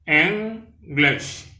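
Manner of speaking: English said /enɡleʃ/, first syllable EN stressed, high and clear; two clear syllables EN-glish; model pronunciation. Shown as a demonstration. Teacher modelling English for French learners.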